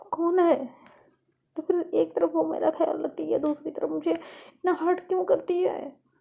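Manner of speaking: put-on upset young woman arguing with herself; distressed soliloquy. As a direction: agonise aloud over someone who hurt you. Lovely alone, agonising over Faiz's behaviour.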